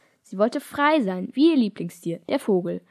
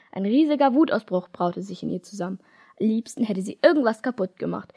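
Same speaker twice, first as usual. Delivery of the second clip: plain reading voice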